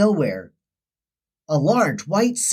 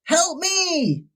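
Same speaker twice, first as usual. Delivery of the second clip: much higher cry for help; a storybook shout, pitched up; 10 semitones higher than usual. A narrator's reading. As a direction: call out as you splash down. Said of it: "shouted Sorry as she hit the water".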